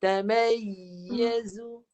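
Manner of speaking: slow demo of a pressed yy in tamayyazu; slightly squeezed. Shown as a demonstration. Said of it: "suara dia akan agak terhimpit sikit".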